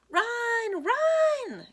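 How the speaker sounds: very high, loud yelling; frantic shout. A narrator's reading. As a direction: scream at him to run. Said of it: "Coach Marie and the other Lions shout".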